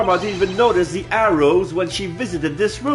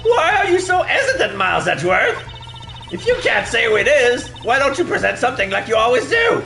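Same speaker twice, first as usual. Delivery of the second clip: high taunting villain voice; 9 semitones higher than usual. Acted. Character taunting prosecutor.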